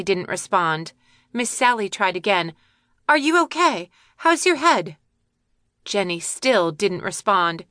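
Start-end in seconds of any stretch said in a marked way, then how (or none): 3.1-5.0 higher concerned bird voice; worried bird. Acted. Miss Sally asks the hurt girl, higher and worried. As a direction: ask gently but higher, worried.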